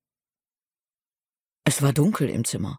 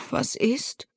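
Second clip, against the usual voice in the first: high, soft, drowsy question; just woken and a little grumpy; grumpy, sleepy; 9 semitones higher, 4 dB quieter than usual. A narrator's reading. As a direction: ask it softly through a half-awake haze. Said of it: "blinzelte unwirsch und gähnte".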